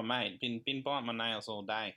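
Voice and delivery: ordinary talk, nothing marked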